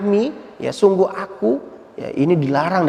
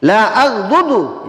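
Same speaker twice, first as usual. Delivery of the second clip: defiant Bedouin; 4 semitones higher, 4 dB louder, much slower than usual. Retold or imitated. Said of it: "Dia berkata, faqala".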